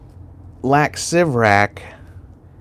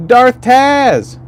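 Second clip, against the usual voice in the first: high, loud happy shout of a name; excited greeting; 10 semitones higher, 5 dB louder than usual. Real, not acted. Greeting a chat regular who just arrived, excited and loud. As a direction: call out to a friend who just showed up.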